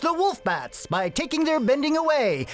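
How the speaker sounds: put-on old-time sportscaster. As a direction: call it like a twenties radio sportscaster. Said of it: "in the style of Shinobi's sportscaster voice".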